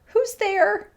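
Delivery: high, scared cat voice; frightened. A narrator's reading. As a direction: call out timidly in a high voice. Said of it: "said the cat in a frightened voice".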